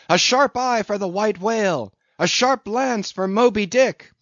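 high, loud battle cry; ringing, high-pitched rallying cry; excited crew shout. A narrator's reading. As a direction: chant it out like roused sailors.

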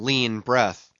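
usual speaking voice

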